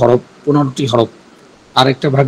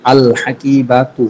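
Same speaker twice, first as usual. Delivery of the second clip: আল হাকিবাতু drawn out very slowly, each sound clear; slow demo; much slower than usual. Shown as a demonstration. Teacher slowly models আল হাকিবাতু for students. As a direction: spell out আল হাকিবাতু slowly for learners.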